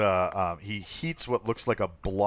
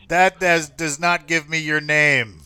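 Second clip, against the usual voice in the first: higher, louder, pressing for a name; stern officer; 7 semitones higher, 8 dB louder than usual. Acted. Harbor officer presses the captain, higher and louder. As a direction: insist like an annoyed official.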